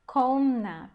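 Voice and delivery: komna with a loud, strong first syllable KO; stress on KO. Shown as a demonstration. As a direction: hit the KO in komna firmly. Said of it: "a sílaba forte é o ko".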